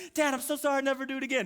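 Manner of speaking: high-pitched mock wailing apology; hammed-up pleading daughter, high voice; imitating screaming, pouting child. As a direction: whine it like a kid mid-tantrum. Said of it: "because she was screaming".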